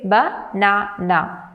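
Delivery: "banana" slowly broken into three separate syllables. Shown as a demonstration. Teacher showing learners three syllables, "banana" split slowly. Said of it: "There are three syllables".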